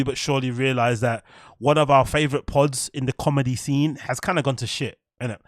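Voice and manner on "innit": ordinary talk, nothing marked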